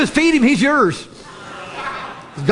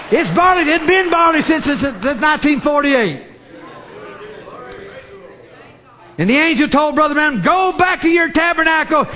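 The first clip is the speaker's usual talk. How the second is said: full-force preaching; 4 semitones higher than usual. Acted. Preacher to congregation about barley season, shouting the prophecy. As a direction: build it up and pound every line.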